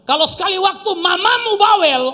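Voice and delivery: high, loud sermon voice; preaching at full force. Real, not acted. Pastor preaches to the unmarried, high and loud.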